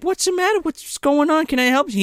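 high worried sister voice; poor Catherine. Retold or imitated. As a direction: mimic an anxious sister, pitched high. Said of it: "poor Catherine's just like".

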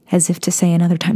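ordinary delivery

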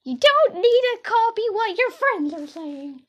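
high, loud, slow dismissive dad imitation; mock dad voice. Imitating her dad dismissing her coming out, mock loud. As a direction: ham up the dad brushing it off. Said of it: "And he goes".

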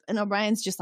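usual speaking voice

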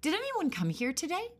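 put-on high, loud suspicious girl; wind girl voice. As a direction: ask it sharply and high.

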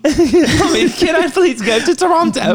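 put-on high, pleading child in a joke Detroit accent; mock Detroit accent. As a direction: beg mummy in a silly high Detroit accent. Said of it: "here's your Detroit accent".

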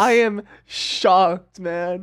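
slow, drawn-out fake shock; mock shock. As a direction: stretch it out, playing shocked. Reacting to jelly bean gagging.

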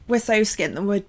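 rushed words through a cough; choking on spit. Real, not acted. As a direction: talk while still choking on spit. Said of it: "I'm choking on my spit".

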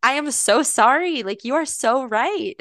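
placating a child, retold; mock contrite apology. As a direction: concede with exaggerated remorse, drawing it out. Mock apology to upset boy.

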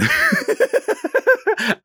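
squealing laugh of despair; high helpless laugh. Real, not acted. Over the fourteen wasted hours, high helpless laugh. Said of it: "I'm laughing 'cause that's all".

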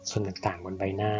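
usual speaking voice

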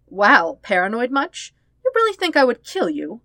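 high, loud mocking scoff; mocking disbelief. Sister mocking her brother's distrust, high and loud. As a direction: scoff at his paranoia.

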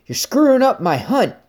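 irate hunter. Retold or imitated. Hunter scolding the speaker for spoiling the hunt, mock angry voice. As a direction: imitate the hunter complaining, high and cross. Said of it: "And he's like".

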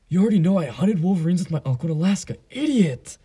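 movie-quote impression for his dad; Napoleon Dynamite. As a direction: scoff it like an annoyed nerdy teen, voice cracking up on idiot. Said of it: "there's a scene in Napoleon Dynamite".

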